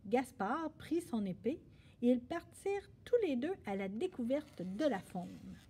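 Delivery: ordinary delivery, nothing marked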